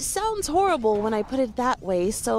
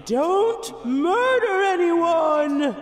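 slow, solemn conscience voice; inner conscience voice; 2 semitones higher, slower than usual. Acted. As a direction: speak as his conscience, drawn out. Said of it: "this is your conscience speaking".

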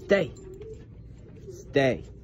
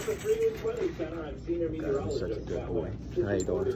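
soft cooing; 2 semitones higher, 3 dB quieter, much slower than usual. Real, not acted. Cooing at dog, soft far voice.

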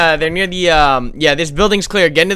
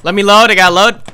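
shouting; 5 semitones higher, 8 dB louder than usual. Real, not acted. Reloading in a firefight.

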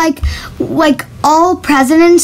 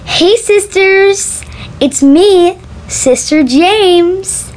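higher, slow drawn-out "Hey sisters" greeting; James Charles impression; 5 semitones higher, much slower than usual. Vlogger recording a line for the voice app, in a mock James Charles greeting. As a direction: stretch out the greeting like a beauty vlogger. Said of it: "let's try James Charles".

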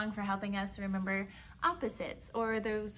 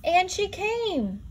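higher, louder, slow joyful announcement; joyful reveal; 8 semitones higher, 6 dB louder, slower than usual. Acted. Storyteller announcing the mother's return, joyful and slow. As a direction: land the happy surprise.

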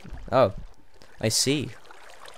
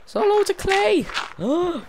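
much higher, giddy voice; squealing excitement; 4 dB louder than usual. Real, not acted. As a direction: squeak with disbelieving delight. Thrilled at finding clay.

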